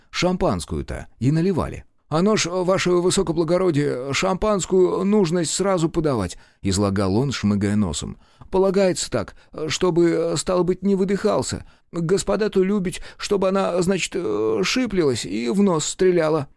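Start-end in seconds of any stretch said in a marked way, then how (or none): 2.1-6.4 high, pleading servant's voice; a nervous lackey explaining himself; a sniffling waiter justifies himself to the officers, high and meek; timid young waiter. A narrator's reading. 8.5-16.5 high, pleading servant's voice; a nervous lackey explaining himself; a sniffling waiter justifies himself to the officers, high and meek; timid young waiter. A narrator's reading.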